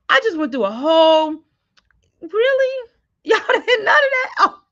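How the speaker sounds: a real, loud, high-pitched "really?" of frustration; exasperated disbelief. Real, not acted. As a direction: laugh through the shock that nobody heard. Realizes audience heard nothing.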